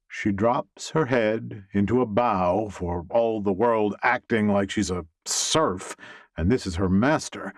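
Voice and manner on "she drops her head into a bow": plain reading voice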